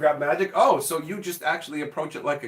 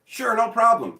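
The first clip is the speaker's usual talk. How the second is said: higher, louder fake-cheery yes; cheery clerk; 7 semitones higher, 6 dB louder than usual. Clerk brushing off the customer, fake-cheery. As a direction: sell the agreement too brightly. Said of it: "clerk voiced in skit".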